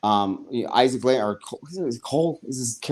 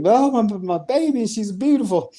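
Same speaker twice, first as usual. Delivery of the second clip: mock doting mother; 11 semitones higher than usual. Podcaster mimics Isaac cooing over baby.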